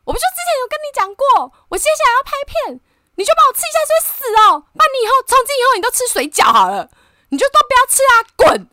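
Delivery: high, loud, fast screaming rant at husband; retold meltdown yell, voice shot up high; breakdown outburst. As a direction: explode in a teary furious tirade. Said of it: "我就开始崩溃哦".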